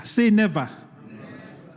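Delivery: usual speaking voice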